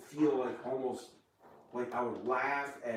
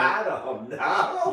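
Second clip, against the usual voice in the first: loud, slow mock-childish whine; whiny loud protest; 6 semitones higher, 11 dB louder, much slower than usual. Deflecting the water question with a loud, drawn-out whine. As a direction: stretch it out like a sulky kid.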